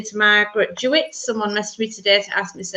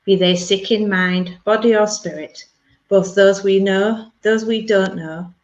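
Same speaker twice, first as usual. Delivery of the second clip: healing prayer; 4 semitones lower than usual. Acted.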